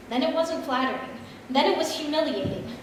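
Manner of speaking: ordinary delivery, nothing marked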